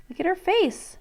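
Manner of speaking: ordinary talk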